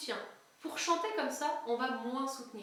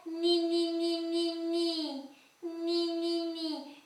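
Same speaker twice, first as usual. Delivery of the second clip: larynx exercise; 3 semitones higher, much slower than usual. Shown as a demonstration. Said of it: "j'ai le larynx très haut".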